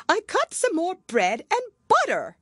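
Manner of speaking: high, loud flustered voice. Acted. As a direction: gabble it out nervously. Hatter voiced.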